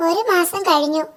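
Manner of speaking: plain reading voice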